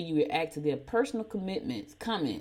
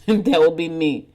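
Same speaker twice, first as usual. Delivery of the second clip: laughing; 9 dB louder, slower than usual. Real, not acted.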